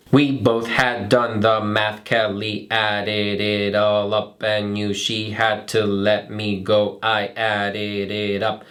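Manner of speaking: slow, low, even robot voice. Shown as a demonstration. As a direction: say every syllable like a robot. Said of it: "it sounds like this ... like a robot".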